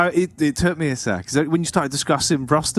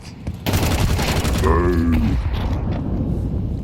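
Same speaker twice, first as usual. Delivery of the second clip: low guttural animal groan; rumbling camel noise on the spot; camel grumble; 12 semitones lower than usual. Pretend. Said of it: "quite a heavy metal cow".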